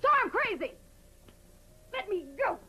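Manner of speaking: high, furious outburst; hysterical shout. Acted. Actress fighting off the one holding her, hysterical shout. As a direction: shout it back, high and wild. Quarrel, being grabbed while hysterical.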